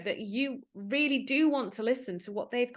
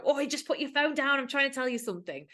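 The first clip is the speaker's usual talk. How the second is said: a mild mock snap, a bit higher; aggressive; 5 semitones higher than usual. Shown as a demonstration. Demo to a phone-distracted partner, mildly mock aggressive. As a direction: demo the pushy way of asking for attention. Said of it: "you can go down the really aggressive route".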